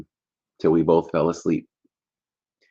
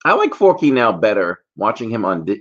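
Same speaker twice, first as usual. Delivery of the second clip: ordinary talk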